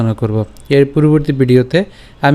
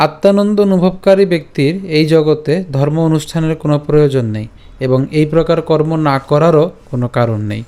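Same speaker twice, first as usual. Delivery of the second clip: ordinary talk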